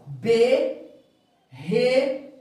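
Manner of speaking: BE, RE, MA with a pause after each syllable. Shown as a demonstration. Teacher reading out what is written, syllable by syllable. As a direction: sound out B, Re, Ma one separate syllable at a time. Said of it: "Aqui eu tenho quantas sílabas?".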